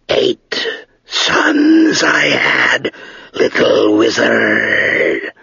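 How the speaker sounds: slow, drawn-out, dry dragon voice; great dry voice of an ancient dragon. Acted. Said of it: "said the great dry voice of the dragon".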